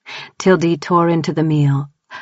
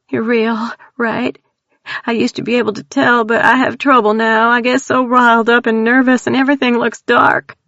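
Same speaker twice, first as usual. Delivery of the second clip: higher, tearful East Texas voice, quick at the end; scared country girl blurting out her terror; frightened Texan woman; 7 semitones higher than usual. Acted. Tildy tells strangers about the clown, high frightened Texan voice.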